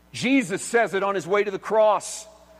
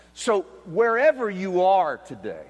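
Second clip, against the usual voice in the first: ordinary delivery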